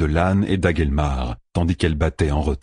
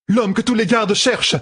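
cry out. A narrator's reading. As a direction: cry out in alarm. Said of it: "s'écria Katana".

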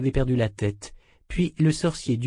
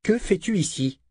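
higher-pitched stern questioning; ton courroucé; 9 semitones higher than usual. A narrator's reading. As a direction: demand an explanation, still cross. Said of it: "toujours courroucé". (question courroucée)

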